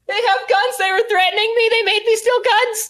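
panicked high screaming. A narrator's reading. Frightened boy screams warning to the rescuers. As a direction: shriek the warning in terror. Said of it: "he screams".

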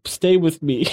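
high-pitched mock plea; whining. Podcaster imitating Kiba begging his rival to stay, whining. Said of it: "like, whining too".

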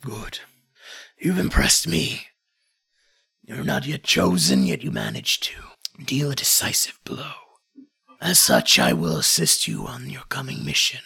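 low, grave lord with whispered asides; gruff warrior lord. Acted. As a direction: speak as a proud, grudging warlord. GM voices NPC speech.

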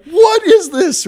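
very high, drawn-out mock wail; outraged child. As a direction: squeal it like an indignant little kid. Retold child's outrage at dad.

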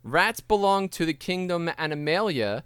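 normal talking voice

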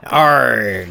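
drawn-out pirate "arr"; comic pirate; pirate accent. Acted. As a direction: chime in with a mock pirate accent. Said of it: "if you do a pirate accent".

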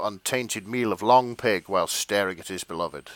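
ordinary talk, nothing marked

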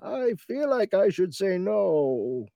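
slow, high cartoon villain; Bane impression. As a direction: draw it out in a high comic villain voice. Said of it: "their Bane is definitely Brandon's Bane".

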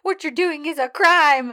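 high, loud imitated outrage; mock freak-out. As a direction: cry out in pretend panic, half laughing. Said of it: "he starts freaking out".

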